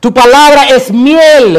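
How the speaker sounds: high, loud, drawn-out proclamation; preaching declamation. Real, not acted. Preacher praising God's word before congregation, loud slow declamation. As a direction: proclaim it slowly at full force.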